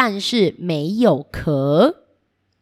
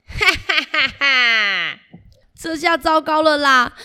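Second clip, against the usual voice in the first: villain laugh; 6 semitones higher than usual. Acted.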